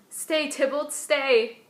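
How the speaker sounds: acted alarm, rising to a loud last word; alarmed cry. Juliet cries out to a ghost.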